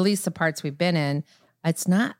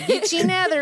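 higher, laughing Southern twang; Southern drawl imitation; 11 semitones higher than usual. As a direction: quote the waiter's drawl with a grin. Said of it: "We've said that for years".

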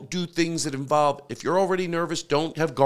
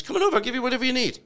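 much higher-pitched imitated English accent; 9 semitones higher, much faster than usual. Neighbour offering to lend hotel things, imitated English accent. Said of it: "he had an English accent".

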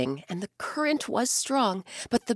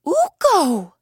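much higher, loud astonished bear; astonished cry; 10 semitones higher, 9 dB louder than usual. Acted. Bear recognising the god Ukko, loud astonished cry. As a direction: gasp out his name in awe. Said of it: "the bear cried".

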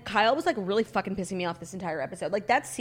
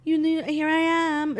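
high, held, sing-song announcement; mock a cringy self-introduction; stretched-out, raised mocking quote; Diana; 6 semitones higher than usual. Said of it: "I did cringe when Diana was like".

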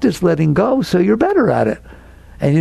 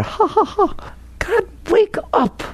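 mocking exasperation; 3 dB quieter, slower than usual. Mock laugh, pleads to listener.

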